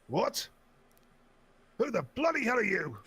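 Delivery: put-on high, loud cranky old codger; put-on old man voice. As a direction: play a grumpy old man fuming at a scammer. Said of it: "I can actually put the voice on".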